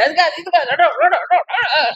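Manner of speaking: hammed-up quarrelsome babble; mock yelling. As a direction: drown the other out with nonsense noise. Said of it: "మరి అరుస్తుంటాడు".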